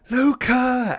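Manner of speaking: high, loud desperate call; calling out for son. Acted. Father to his lost son, calling out loudly. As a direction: shout the name out, high and drawn-out.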